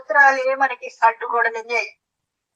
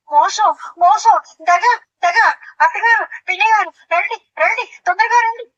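high, loud cry of betrayal calling for help; outraged shout; 7 semitones higher, 4 dB louder than usual. Acted. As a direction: yell for the in-laws to come running. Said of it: "ఎందుకు అలాగా అరుస్తున్నావు". (మోసం అని గట్టిగా అరుస్తూ అత్తగారిని పిలవడం)

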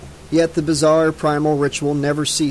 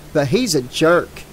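ordinary delivery

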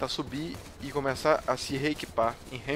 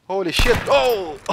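loud, higher shocked cry; shocked yell; 7 semitones higher, 12 dB louder, slower than usual. Real, not acted. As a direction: blurt it out in shock. Teammate killed.

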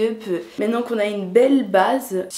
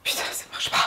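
whisper; 9 dB quieter, faster than usual. Real, not acted. Product not working, muttered.

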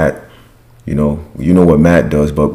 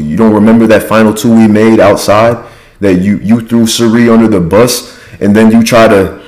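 louder, higher-pitched imitated quarrel; mock indignant rant, pitched up; acted accusation; 5 semitones higher, 4 dB louder than usual. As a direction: fire back accusations as if in an argument.

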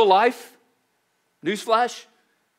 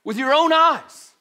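slow, high and louder, pointing it out to the crowd; emphatic preaching; 8 semitones higher, 6 dB louder, slower than usual. Retold or imitated. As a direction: drive it home to them, each word weighed. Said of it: "he's pointing out".

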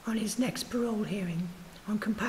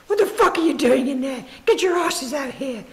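loud, higher bellow from the doorway; 4 semitones higher, 10 dB louder than usual. A narrator's reading. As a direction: bellow it at them across the room. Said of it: "bellowed a voice from the door".

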